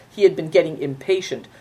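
usual speaking voice

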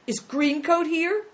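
high, slow, loud question; alarmed cry. Acted. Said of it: "cried the boy, opening his eyes instantly".

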